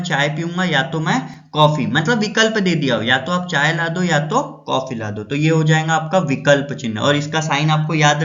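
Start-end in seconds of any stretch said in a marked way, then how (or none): none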